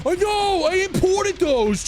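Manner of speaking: whiny merchant. Acted. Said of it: "he's, like".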